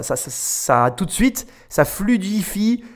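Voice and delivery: ordinary talk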